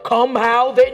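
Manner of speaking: come hither. Retold or imitated. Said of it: "it's not like".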